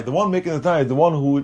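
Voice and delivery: normal talking voice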